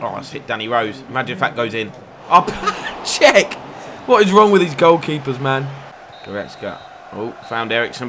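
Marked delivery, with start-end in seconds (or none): 2.3-3.5 a high, loud shocked cry of the name; shocked shout. Real, not acted. Keeper's blunder. 4.1-5.7 exasperated rant. Real, not acted. Keeper blunder rant.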